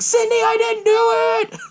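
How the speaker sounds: high-pitched pleading call; mock desperate cry. Billy calling to Sidney across the sheriff's office, mock desperate cry.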